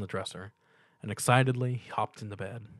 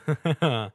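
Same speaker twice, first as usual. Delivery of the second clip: nervous laugh; 4 semitones higher, 5 dB louder than usual. A narrator's reading. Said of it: "laughed nervously".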